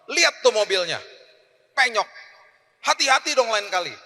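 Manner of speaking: high, loud mock scolding of a child; imitated angry scolding. Parent scolding child over the dented car, mock angry. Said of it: "marah-marah dan kasih tahu".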